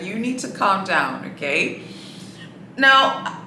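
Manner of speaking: ordinary delivery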